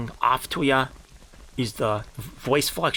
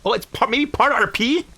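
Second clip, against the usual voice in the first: mocking concession; 9 semitones higher, 3 dB louder than usual. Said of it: "mocking the RP accusation".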